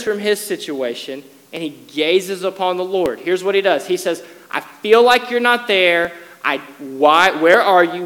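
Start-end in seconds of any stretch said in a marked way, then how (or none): 4.5-8.0 loud, raised outcry to God; preacher acting out a loud lament; acted desperate complaint. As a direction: voice the psalmist's protest, stumbling into the question. Acts David's complaint to God.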